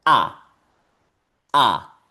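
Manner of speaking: "A" as one short open falling vowel; single-vowel demo. Shown as a demonstration. Teacher showing the other pronunciation of ER, just "A". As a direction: model a lone "A", no E before it. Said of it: "solamente sonar".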